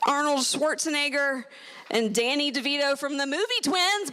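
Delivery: ordinary talk, nothing marked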